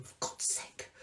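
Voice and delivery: breathy under-the-breath whisper; whispered aside. Real, not acted. Hiding from Mark in the next room, a whispered aside. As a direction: whisper it so Mark won't hear.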